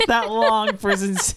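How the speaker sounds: words through a chuckle; laughing. Real, not acted. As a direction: laugh while saying it. Laughing at his own joke.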